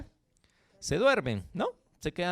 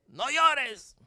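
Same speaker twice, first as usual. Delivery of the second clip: sharp, higher mock scold to a crying child; a parent snapping at a fallen child; 8 semitones higher than usual. Parent telling a hurt child to stop crying, mock scold. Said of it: "¿y tú qué le dices?".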